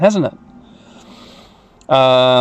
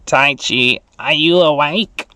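Agumon voice; slower than usual. Acted. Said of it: "says Agumon".

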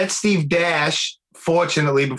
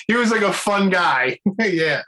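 ordinary talk, nothing marked

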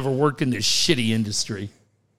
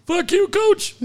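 much higher, spat curse; squeaky angry caller; 14 semitones higher than usual. Pretend. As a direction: shriek it at the coach. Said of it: "caller curses coach".

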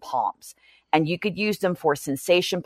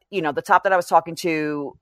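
normal talking voice

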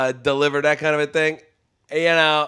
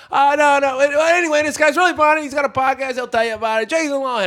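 rushed host intro; 10 semitones higher than usual. Retold or imitated. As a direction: hurry the intro in mock panic. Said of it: "So he's like".

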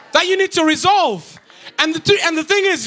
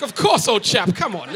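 put-on posh English accent; 6 semitones lower than usual. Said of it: "All the Europeans are like".

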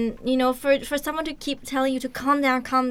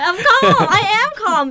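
high, loud, laughing protest of being calm; mock angry insistence; 10 semitones higher, 7 dB louder than usual. Mad person claiming to be calm, in mock outrage. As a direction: play someone who is obviously not calm. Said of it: "is getting mad".

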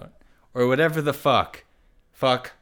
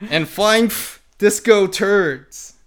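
excited; 9 semitones higher, 5 dB louder than usual. Acted. Reading climax with rising excitement.

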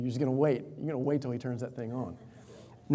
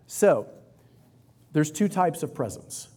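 ordinary delivery

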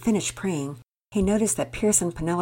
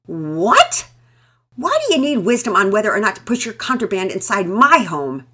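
loud, outraged questioning; angry; 5 semitones higher, 11 dB louder than usual. A narrator's reading. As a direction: hurl it back indignant. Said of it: "was not giving an inch".

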